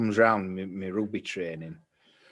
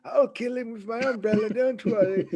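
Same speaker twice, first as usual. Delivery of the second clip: mock reassuring teacher; 12 semitones higher, 4 dB louder than usual.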